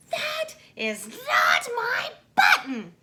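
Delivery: high, loud, slow shout; shouting Toad. A narrator's reading. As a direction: yell it at Frog. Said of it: "shouted Toad".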